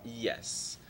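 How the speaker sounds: ordinary talk, nothing marked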